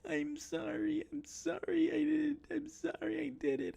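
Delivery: very high mock-pleading wail; hammed-up begging whine; whining woman. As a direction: imitate a desperate girlfriend in squeaky falsetto. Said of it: "with each whine of this woman".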